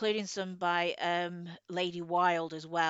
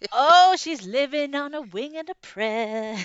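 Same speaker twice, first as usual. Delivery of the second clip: teasing; 7 semitones higher, 11 dB louder than usual. Acted. Teasing co-host, laughing.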